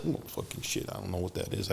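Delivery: soft, breathy nervous denial; hushed panic. Retold or imitated. Caught inmate mumbling to the narrator in panic. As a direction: mutter it like you've been caught.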